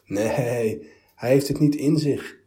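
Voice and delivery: loud, high scornful dismissal; pitying scorn. A narrator's reading. Bystanders scoff at the wretched God, loud and pitying. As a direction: look down on him and scoff. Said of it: "zeiden anderen die meewarig neerkeken".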